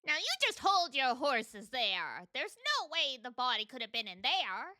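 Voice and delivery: lightly put-on Southern accent, folksy; silly southern accent. Landlady warning the detectives, in a silly Southern accent. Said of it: "the silly southern accent".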